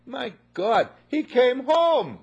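high, loud, slow cry of joy; overjoyed father. Acted. Father seeing his lost son come home, overjoyed. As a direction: marvel that he's home.

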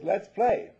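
a dog cheerfully inviting a pal to play; playful invitation. Acted. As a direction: bounce it like a dog ready to romp.

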